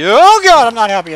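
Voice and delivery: very high, loud dismayed cry. Real, not acted. Gamer reacting to a sudden reversal, loud dismayed cry. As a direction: yelp as the match turns.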